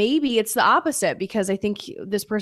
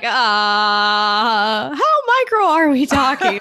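mock disbelief; 3 semitones higher, 4 dB louder, slower than usual. Her reply to the date.

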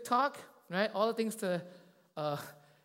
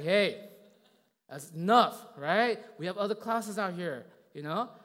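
loud, firm acted scolding; exasperated adult laying down the law; stern telling-off; 3 semitones higher, 11 dB louder than usual. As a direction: raise your voice to shut down a crying kid. Acting out scolding a crying kid.

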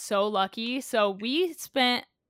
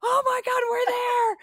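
high, loud strained whisper scream; whisper yelling; 6 dB louder than usual. Retold or imitated. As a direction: shriek it but keep it hushed. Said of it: "we're whisper screaming".

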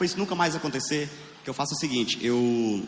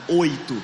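louder flat Oito; stress on Oito; 5 dB louder than usual. Real, not acted. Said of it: "O cara dá oito descarga".

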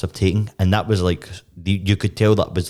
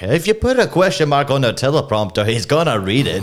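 high-pitched movie quote; mock exasperated movie line; 7 semitones higher, 3 dB louder than usual. Acting out an Anchorman line.

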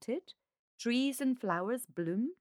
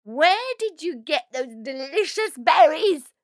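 high, very loud screech; piercing, demanding shriek; 8 semitones higher, 12 dB louder than usual. Acted. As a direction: screech the question greedily. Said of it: "screeched Helena".

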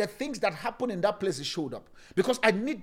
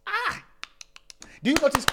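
ordinary talk

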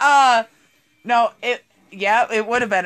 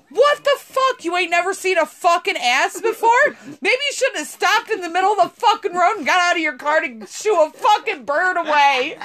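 higher-pitched mock yell at a gawker, a bit fast near the end; retold yelling; 7 semitones higher than usual. Telling off the old guy gawking from his car, half-yelled. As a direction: replay the outburst with some heat but not full volume. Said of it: "And I am yelling this".

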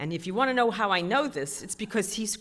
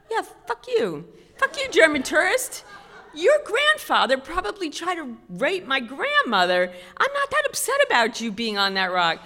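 higher, louder gleeful outburst through laughter; mock angry rant; 8 semitones higher, 8 dB louder than usual. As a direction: laugh while cursing him out. Voiced hostile thought at tourist.

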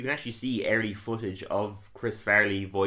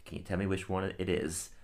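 ordinary talk, nothing marked

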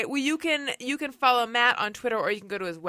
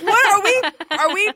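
high, loud, laughing disbelief; incredulous laughing squeal; 10 semitones higher, 11 dB louder, slower than usual. Retold or imitated. Incredulous retold quote to boss.

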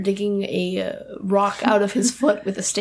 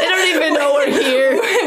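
raised, slower, laughing; louder laughing shout; 4 semitones higher than usual. Real, not acted. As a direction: call it out laughing. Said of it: "repeated louder".

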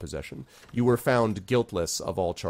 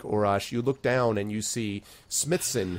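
normal talking voice

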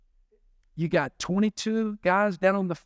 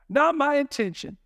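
usual speaking voice

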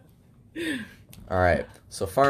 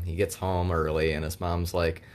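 ordinary delivery

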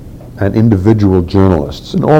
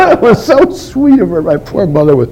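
talking through real laughter, voice higher; laughing; 10 semitones higher than usual. Real, not acted. Fondly recalling his mother, laughing while speaking. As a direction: let the laugh carry the words.

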